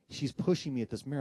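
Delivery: plain reading voice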